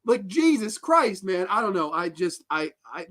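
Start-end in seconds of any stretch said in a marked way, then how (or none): 0.0-1.4 high, squeaky incredulity; real comic meltdown of bewilderment; losing it. Real, not acted. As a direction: plead in exasperated disbelief, voice way up. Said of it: "I'm losing my shit".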